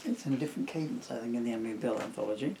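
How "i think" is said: usual speaking voice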